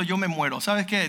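usual speaking voice

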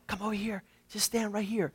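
very soft, coaxing undertone; hushed tempter's invitation; luring devil. Acted. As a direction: quietly beckon someone into a trap. Devil luring the listener, acted.

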